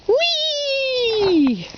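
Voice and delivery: high, excited whee as the dough flies; gleeful whee. A narrator's reading. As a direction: squeal it like a happy kid. Pizza dough tossed in the air.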